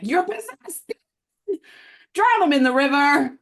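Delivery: high, loud frantic accusation; mock villager outrage. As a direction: shriek it like a panicked villager. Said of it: "they're like".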